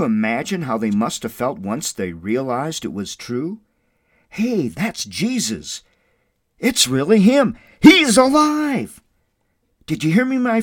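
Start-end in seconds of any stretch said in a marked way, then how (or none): 4.3-10.6 rising from amazed to loud, high joyful cries; excited disciples. Acted. Disciples crying out to each other that Jesus lives, building to a shout.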